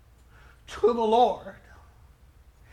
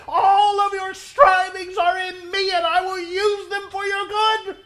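high, loud, tearful preaching cry; crying shout; 9 semitones higher, 11 dB louder than usual. Acted. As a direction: plead at the top of your voice, near tears. Jesus pleading, preacher at full force.

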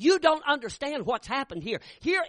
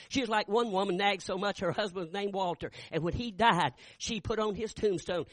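ordinary talk, nothing marked

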